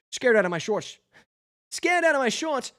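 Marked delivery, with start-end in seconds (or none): 1.7-2.7 the line pitched much higher, rising on out; higher retake. Shown as a demonstration. Reader redoes the bee's line, much higher and jittery. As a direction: squeak it out like a jittery bee. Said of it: "retake after a plain read".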